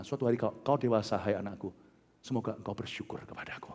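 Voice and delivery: low, softening to a near whisper; tender fatherly hope. Retold or imitated. Preacher voices God hoping His child matures, tender and hushed. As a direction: speak gently down to a beloved child.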